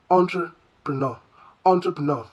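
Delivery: entrepreneur as on-truh-puh-NAW, falling; correct French-style entrepreneur. Shown as a demonstration. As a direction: repeat entrepreneur with a French "on" start and "naw" ending. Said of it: "a soft flowing pronunciation".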